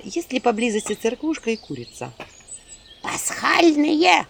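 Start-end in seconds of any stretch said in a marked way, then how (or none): 3.0-4.3 slow high drawn-out imp cry; imp chorus. Acted. Imps chiming in almost in chorus. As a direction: call it out together like imps. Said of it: "почти хором заметили бесы".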